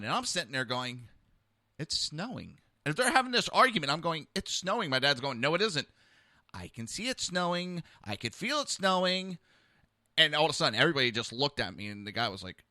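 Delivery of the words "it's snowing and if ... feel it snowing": dazed hallucination. Retold or imitated. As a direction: say it like you truly see snow falling. Hallucinating under interrogation pressure.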